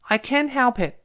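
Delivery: put-on high-pitched, whiny child excuse; acted little boy's protest; protesting son. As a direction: plead helplessly to mum. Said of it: "儿子说".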